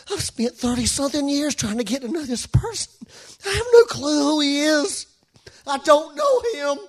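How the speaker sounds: agonized, welling-up confession; emotional. Real, not acted. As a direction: let real distress push the words out. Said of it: "I'm sorry I'm being so emotional".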